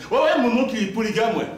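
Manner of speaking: loud, high, boastful; cocky yes-yes; proud answer. Retold or imitated. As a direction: brag about it, grinning.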